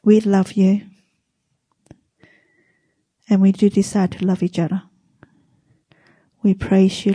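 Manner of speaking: hushed church prayer. Acted. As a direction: pray it gently to the Lord. S0 closing prayer.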